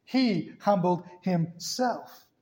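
"He humbled himself" in slow, separated words; emphatic word-by-word. Shown as a demonstration. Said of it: "Did you notice that?".

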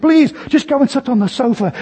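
high, wheedling mother's voice; mother desperately bargaining with her boy; pleading mother. Retold or imitated. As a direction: bribe the child, pitch up high.

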